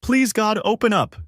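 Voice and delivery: urgent yell, pitched well up. A narrator's reading. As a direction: cry out like a father pleading through a door. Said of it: "Dad's voice yelling".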